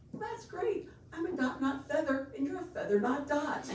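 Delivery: a hint of the exchange student teasing back; mock Anshul. Student ribbing the host family in a light mock voice.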